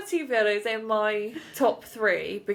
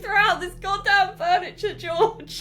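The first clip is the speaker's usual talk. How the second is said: high-pitched comic outburst, half laughing; hammed-up indignant dad; exasperated dad; 9 semitones higher than usual. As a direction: rant like a mock-outraged dad. Acting the dad scolding George.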